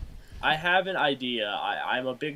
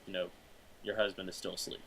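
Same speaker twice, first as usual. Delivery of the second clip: hushed, much softer voice; quiet; 7 semitones lower, 15 dB quieter, faster than usual. Real, not acted. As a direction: speak low so the husband sleeps. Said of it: "And you kinda have to be quiet".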